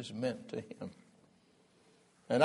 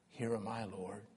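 humble answer; 3 semitones lower, 8 dB quieter than usual. Acted. Abraham humbly answers God.

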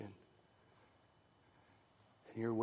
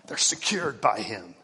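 much louder, ringing affirmation; sudden loud declaration; 8 dB louder than usual. Real, not acted. Preacher declaring security loudly to believers. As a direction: punch it out after the hush. Burst after whisper.

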